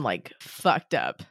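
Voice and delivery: low, slow, rough from a cold; sick voice. Real, not acted. Talking about her sick voice, low and slow. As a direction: voice worn down by a cold. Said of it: "My voice has just been".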